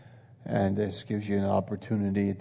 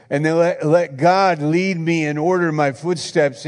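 raised, loud preaching cadence; fervent preaching; 7 semitones higher, 7 dB louder than usual. Acted. Pastor preaching to the church, loud and fervent. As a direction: preach it out to the congregation.

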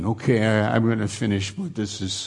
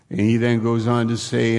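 normal talking voice

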